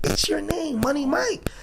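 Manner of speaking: high, bright imitation of a coworker; imitating coworker. Imitating coworker explaining the name.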